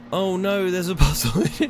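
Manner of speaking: high, loud "oh no" dismay; real dismay. Real, not acted. Player spots yet another puzzle, loud dismay. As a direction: cry out as the dreaded thing appears.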